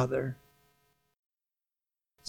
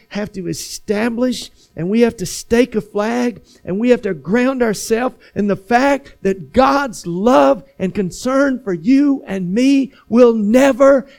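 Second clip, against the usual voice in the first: preaching that builds louder and higher; emphatic preaching; 5 semitones higher, 7 dB louder than usual. Real, not acted. Preacher assures congregation of God's endless love, building emphatic crescendo. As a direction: hammer each "ever", slowing into "end".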